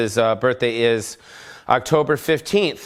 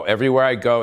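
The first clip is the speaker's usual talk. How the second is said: usual speaking voice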